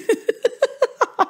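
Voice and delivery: high, staccato giggle; high-pitched cackling laughter. Real, not acted. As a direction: burst into a long string of high ha-has. Laughs at own sightseeing joke.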